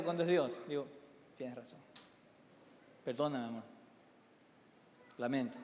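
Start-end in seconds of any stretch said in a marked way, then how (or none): 1.4-5.6 soft, low, humbled voice; hushed and lowered, giving in; meek apology. Retold or imitated. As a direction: murmur a contrite apology to your wife. Acts his apology to his wife.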